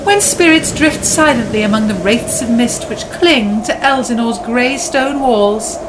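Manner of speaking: dramatic story narration. A narrator's reading. Dramatic narration of Hamlet scene.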